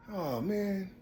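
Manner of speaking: quiet, subdued demo voice; demonstrated soft voice. Shown as a demonstration. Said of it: "I just talk like this".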